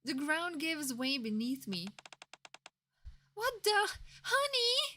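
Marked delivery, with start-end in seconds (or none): 3.4-5.0 startled. Acted. Falling through the floor.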